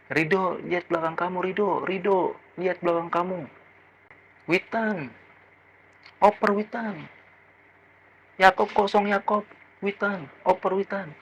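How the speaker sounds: mock calling out, high but soft; a fan's urgent shouts, shrunk to a small high voice; imitated supporter yelling. Supporter calling to defenders from the stands, mock shouting. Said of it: "dia juga sering menyebut bek kita".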